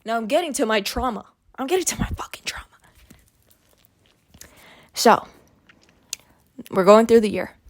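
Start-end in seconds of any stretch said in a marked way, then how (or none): 1.6-2.7 quick, hushed whisper; fast whisper. Real, not acted. Teasing viewers about the trauma ahead, fast whisper. Repeats it dramatically.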